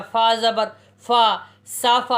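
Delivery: put-on teacher sounding out each syllable for children; धीरे-धीरे हिज्जे करके जोड़ना; slow spelling then blend. Shown as a demonstration. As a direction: spell फा ज़बर फा slowly, then join into साफा. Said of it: "फा के ऊपर कौन सी हरकत है?".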